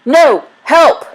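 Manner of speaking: high, loud cries for help; panicked cries. Acted. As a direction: yell out in terror of capture. Chased man cries for help.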